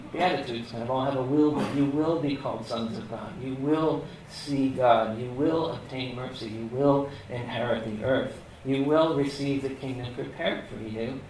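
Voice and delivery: usual speaking voice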